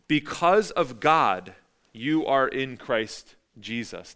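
slow, weighty, each word set apart; deliberate, slowed-down quotation; slow scripture reading. Shown as a demonstration. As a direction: read it out slowly as scripture. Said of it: "he says it like this".

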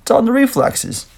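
high-pitched catchphrase impression; movie quote impression. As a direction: lightly mimic the film line. Quoting the film's catchphrase.

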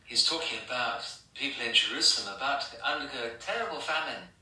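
ordinary delivery

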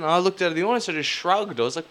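ordinary delivery

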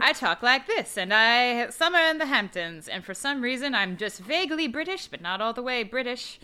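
lightly put-on old-timey mid-Atlantic accent; a hint of upper-crust mid-Atlantic accent, vaguely British. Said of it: "it's the old-timey mid-Atlantic accent".

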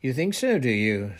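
loud, high scornful retort. A narrator's reading. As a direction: throw it back with open scorn. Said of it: "said Professor McGonagall scornfully".

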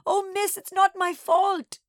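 high, loud, drawn-out sobbing wail; hammed-up tearful wail. A narrator's reading. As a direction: sob it out like a child pleading innocence. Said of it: "Nana sobbed".

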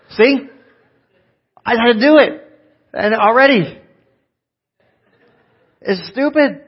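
high, loud, fired-up preaching; full-force preaching. Acted. Pastor urging the congregation to smile, fired-up preaching. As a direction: drive the point home to the congregation.

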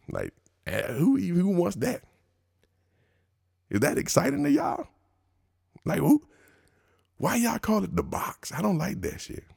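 high, incredulous who-wants-that; incredulous disbelief. Real, not acted. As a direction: sound baffled and grossed out. Mocking disbelief at the term.

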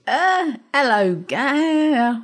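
slow, high-pitched mock British accent; exaggerated mock British greeting; mimicking his accent. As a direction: imitate the British host's put-on voice, dragged out high. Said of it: "it's pretty close".

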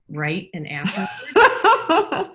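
slow words through a real laugh. Real, not acted. To the hosts, joking about her husband's afterword, laughing. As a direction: laugh it out slowly.

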